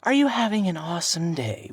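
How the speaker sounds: high-pitched mock-perky cashier; chirpy counter girl. Quoting the cashier's loaded greeting back, mock chirpy. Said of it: "the girl behind the counter said".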